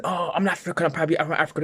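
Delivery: high-pitched mock proud declaration; mocking quote, pitched well up; imitated proud claimant. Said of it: "you don't see Chinese people saying".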